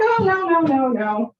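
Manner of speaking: mock protesting teenager brushing off suspicion; quick denial. Daughter brushing off mom's offer to bail her out, mock protesting.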